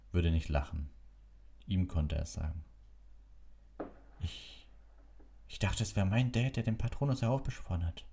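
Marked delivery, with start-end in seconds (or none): 4.2-8.0 put-on unsure boy. A narrator's reading. Harry voiced in story.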